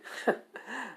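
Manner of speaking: a quick high smug laugh; smug chuckle. Acted. Belle chuckles at how easy the dungeon is, smug laugh. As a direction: laugh it off, cocky.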